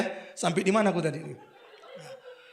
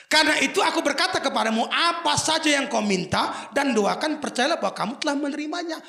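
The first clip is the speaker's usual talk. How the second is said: loud, raised preaching read; scripture reading; 5 semitones higher, 4 dB louder than usual. Acted. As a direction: proclaim the verse to the hall. Reads scripture again. (membaca ayat dengan suara lantang berkhotbah)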